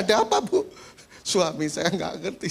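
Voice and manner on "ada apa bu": much higher, alarmed pastor asking what's wrong; startled question. Acted. Pastor shocked as the wife asks for divorce, alarmed question. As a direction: jump up in pitch, caught off guard.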